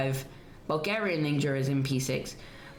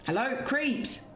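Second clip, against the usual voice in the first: welcoming someone home; 6 semitones higher than usual. Real, not acted. Greets friend back in call.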